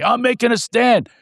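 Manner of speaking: high-pitched defiant friend, acted out; imitated indignant accusation; indignant retort. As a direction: challenge them, pitched well up.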